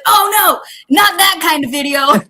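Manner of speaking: high, loud retold protest; alarmed denial. Telling chief of staff about the video, alarmed denial. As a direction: blurt out a mock-horrified correction, loudly.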